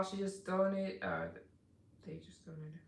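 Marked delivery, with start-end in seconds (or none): none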